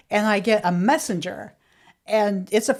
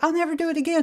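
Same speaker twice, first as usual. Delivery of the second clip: higher, sheepish promise; contrite mom voice; 8 semitones higher, much faster than usual. Retold or imitated. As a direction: play the guilty mother, pitched up. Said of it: "she was like".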